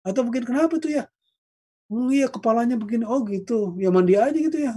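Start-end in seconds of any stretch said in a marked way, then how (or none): 0.5-1.1 high, casual, careless asking; mock casual asking. Second mocking indifferent exchange. 1.9-4.7 higher, offhand brush-off; mock dismissive reply.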